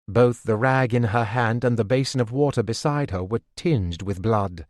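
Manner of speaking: ordinary delivery, nothing marked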